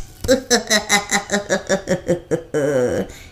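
gloating genie cackle; evil laugh. Acted. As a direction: laugh in triumph. Genie laughs after trick.

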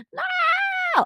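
very high, drawn-out mock scream; mock wail. Host lamenting another failed talking stage, mock wailing. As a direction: wail a long high "no" in pretend despair.

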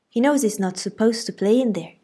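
Aunt Alexandra scolding from the house, loud stern voice. A narrator's reading.

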